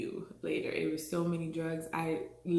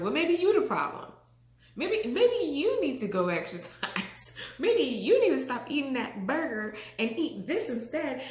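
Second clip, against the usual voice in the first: higher, louder blaming the husband; mock accusing; 8 semitones higher, 6 dB louder than usual. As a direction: toss the blame back at him, half joking. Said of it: "I'm like".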